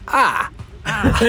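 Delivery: "Ah" said as an open drawn ah with no r; Boston non-rhotic "Ah"; Boston r-drop. Shown as a demonstration. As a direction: drop the R and just say "Ah". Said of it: "you say".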